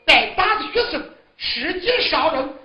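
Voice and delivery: a big scolding shout, loudest at the start; mother shouts at her son as he chases with a sword. Acted. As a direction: call him out sharply, like a mother stopping her son. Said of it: "一声大喊".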